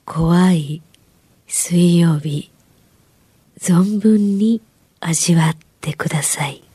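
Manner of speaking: slow low eerie whisper; creepy whisper. Acted. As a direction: lure them into the fear. Creepy sign-off for the show.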